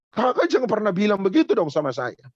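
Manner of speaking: mild mock hurt anger; angry teen. An offended teen scolding his youth leader, mildly angry. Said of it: "Dan dia marah".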